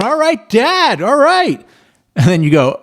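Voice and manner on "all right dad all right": put-on much higher, slow, drawn-out kid cheer; a kid's happy squeal of approval; excited kid. Kids cheering dad's promise.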